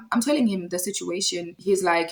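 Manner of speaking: normal talking voice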